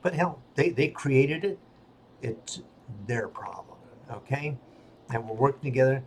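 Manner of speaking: ordinary delivery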